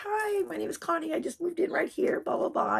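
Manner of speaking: high-pitched chirpy hello; friendly greeting. Retold or imitated. New neighbour introducing herself to elderly lady, friendly high greeting. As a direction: introduce yourself in a high, sociable voice.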